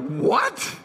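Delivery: mock-incredulous one-word question; retold disbelief; baffled boy's reaction. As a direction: react as a confused kid, lightly acted. Boy reacts to dad's plan.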